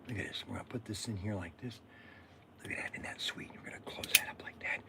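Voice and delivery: hushed, unvoiced, a bit fast; whisper. Real, not acted. Loading caps, whispering to viewers. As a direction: whisper it like a secret to the camera.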